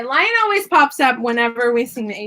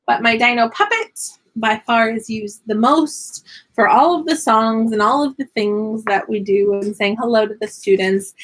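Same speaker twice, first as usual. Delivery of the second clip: normal talking voice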